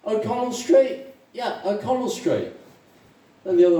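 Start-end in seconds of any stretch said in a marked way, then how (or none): 0.1-1.1 high-pitched, surprised query; surprised question. Acted. Irishman asking a fellow drinker where he's from, surprised. First Irishman in joke asks. 1.3-2.5 lightly acted, higher-pitched agreement; eager confirmation. Irishman confirming to a fellow drinker, eagerly.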